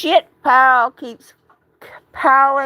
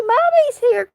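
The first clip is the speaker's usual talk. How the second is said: much higher sing-song mommy voice; 11 semitones higher than usual. Real, not acted. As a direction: reassure the cat in a high coo. Soothing her upset cat.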